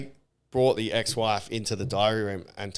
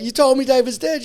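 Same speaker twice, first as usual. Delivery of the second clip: high, loud mock accusation; imitated indignant retort; 12 semitones higher, 6 dB louder than usual. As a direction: throw it back, mimicking outrage. Acts out upset housemate's retort.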